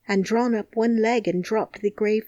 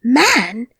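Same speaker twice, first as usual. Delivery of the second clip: startled, louder exclamation; low quick cry; 4 semitones higher, 8 dB louder than usual. Acted. Dickon and Mary jump up startled, quick and louder. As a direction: snap the word out in alarm. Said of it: "cried in low, quick voices".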